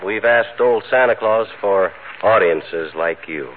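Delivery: host signing off with gratitude; formal closing speech. Acted. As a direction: thank the audience warmly and slowly. Formal sign-off to audience.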